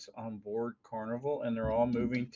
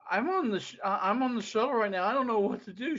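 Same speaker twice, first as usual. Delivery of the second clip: flustered; 8 semitones higher, faster than usual. Retold or imitated. Stuck on shuttle, mother calling.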